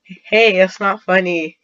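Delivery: loud, indignant complaint; complaining. Acted. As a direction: protest loudly at being laughed at. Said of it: "he complains".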